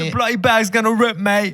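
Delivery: put-on Australian accent. Mock contestant warning a mate, Australian accent.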